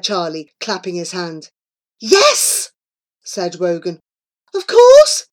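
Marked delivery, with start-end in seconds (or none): 2.0-2.7 very high, loud yes; excited cheer. A narrator's reading. Wogan joining the cheer for science, excited. 4.5-5.3 very high, very loud exclamation; overexcited. A narrator's reading. Wogan seizing on Flora's science plan, overexcited.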